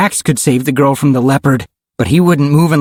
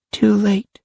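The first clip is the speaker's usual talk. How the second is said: put-on high little-girl voice; softly final, in a child's high voice; little girl Karen; 11 semitones higher, 3 dB quieter than usual. A narrator's reading. Karen answers the screaming friend, solemnly. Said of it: "Karen said again".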